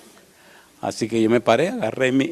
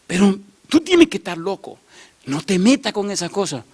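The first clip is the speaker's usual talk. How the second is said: raised-pitch mock warning; scolding mother; 6 semitones higher than usual. Mother warns son off the witch's house, alarmed scolding. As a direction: imitate an alarmed mother telling off her son.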